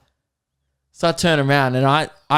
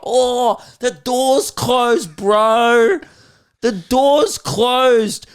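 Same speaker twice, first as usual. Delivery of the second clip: high, loud, drawn-out jeer; mock him like a cocky kid heckler; stretched, condescending twelve-year-old taunt; 11 semitones higher, 5 dB louder, much slower than usual. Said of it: "most condescending shit".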